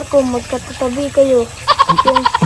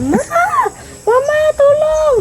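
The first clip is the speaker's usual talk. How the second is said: pretend scream for mama; 14 semitones higher than usual. Kid answering what she'd shout, high-pitched yell. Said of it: "Ano isisigaw mo?".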